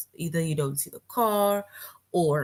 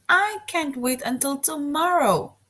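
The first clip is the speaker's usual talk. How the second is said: high, louder eager exclamation; excited; 8 semitones higher, 3 dB louder than usual. Shown as a demonstration. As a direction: read it like a kid who can't wait. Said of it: "the next one is".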